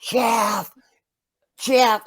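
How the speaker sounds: high-pitched call, held back in volume; restrained mock yell. Boy calling his friend back with neighbors everywhere, restrained high yell. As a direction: yell his name without being too loud. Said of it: "and I'm yelling ... I can't be too loud".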